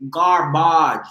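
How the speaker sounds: "Garbage" as gar-BAHZH, pitched low; "Garbage" with a long AH in the last syllable, low; French-style garbage. Shown as a demonstration. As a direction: say "Garbage" like a French word, rhyming with "mirage". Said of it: "Garbage is French".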